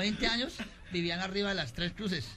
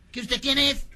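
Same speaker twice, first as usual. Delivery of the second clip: higher, louder comic interrogation; insistent demand; 6 semitones higher, 5 dB louder, slower than usual. Acted. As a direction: demand an answer, raised voice. Marciano interroga cada vez más fuerte. (pregunta insistente y alzada)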